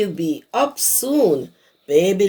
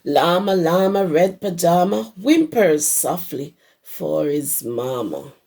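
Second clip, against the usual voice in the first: usual speaking voice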